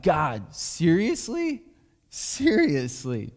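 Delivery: pleading, stretched high seriously; anguished cry out. Retold or imitated. Pastor crying out to God in the car, slow anguished plea. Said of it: "I, I cry out".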